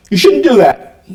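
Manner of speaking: put-on a mother scolding her little girl. Wife scolds little daughter.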